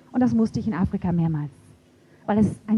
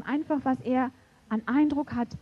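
ordinary talk, nothing marked

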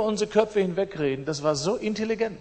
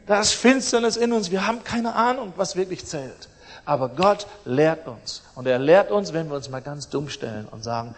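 ordinary talk

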